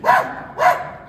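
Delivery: repeated very high panicked shrieks; hammed-up shrieks, as if being pecked; mock screaming. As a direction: scream in short comic bursts. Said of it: "And I'm screaming".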